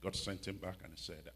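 ordinary talk, nothing marked

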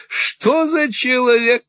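put-on high, wheedling old miser voice; fawning old moneylender. Old usurer fawning on Ralph, high and wheedling. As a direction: simper it like a cringing usurer. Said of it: "снова воскликнул".

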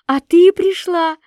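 high, slow joyful cry; delighted exclaim. A narrator's reading. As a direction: burst out with glee.

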